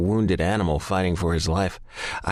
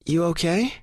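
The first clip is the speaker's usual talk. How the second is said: sexy tone; 11 semitones higher than usual. A narrator's reading. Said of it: "in that sexy tone of hers".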